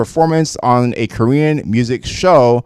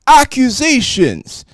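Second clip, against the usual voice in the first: drawn out, much higher and dramatic; high dramatic exclamation; 11 semitones higher than usual. Shown as a demonstration. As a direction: exclaim it slowly with a big rise.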